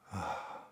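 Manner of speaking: soft breathed-out "ah"; sigh of release. Shown as a demonstration. Meditation host showing the body softening, soft sighed "ah". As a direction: let "ah" melt out on the breath, almost voiceless.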